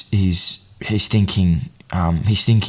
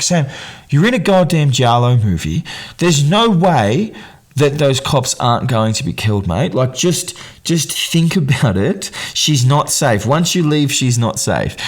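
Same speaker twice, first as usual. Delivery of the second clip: higher-pitched, incredulous telling-off; exasperated plea; 6 semitones higher than usual. Acted. Podcaster warning Sam about the movie he's in, exasperated. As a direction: plead with him to see sense, stressing hard. Scolding a film character.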